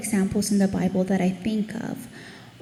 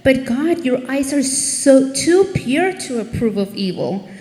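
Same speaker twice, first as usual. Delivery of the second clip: pleading protest; 3 semitones higher, 5 dB louder than usual. Retold or imitated. Acting Habakkuk's pleading argument with God.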